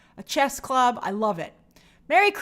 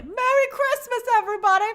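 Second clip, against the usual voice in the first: George Bailey; 11 semitones higher than usual. Retold or imitated. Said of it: "I feel like, uh, uh, George Bailey".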